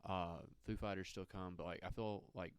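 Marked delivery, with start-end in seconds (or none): none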